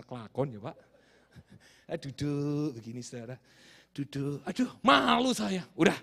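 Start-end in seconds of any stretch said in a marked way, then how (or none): none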